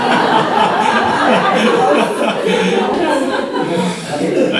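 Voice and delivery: loud, high laugh that breaks out; laughing out loud at his own joke; real burst of laughter. Real, not acted. Preacher to congregation, joking about his wife, laughs out loud.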